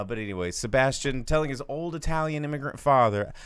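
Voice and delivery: usual speaking voice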